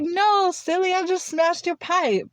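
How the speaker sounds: high voice, drawing out the ending; imitated playful reassurance. Woman with hammer reassuring the teller, imitated playful tone. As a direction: brush the fear off in a high, silly-you voice. Retold light reply dismissing the fear.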